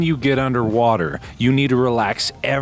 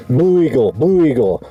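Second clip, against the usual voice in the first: louder call sign shout; loud radio call; 9 dB louder than usual. Pretend.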